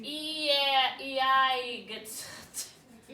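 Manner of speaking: every one of "E, I, G, T" given its own sound; weird letter-by-letter pronunciation. Shown as a demonstration. Teacher pretending not to know letter teams, "E, I, G, T" each sounded separately. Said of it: "pronounce it the weird way".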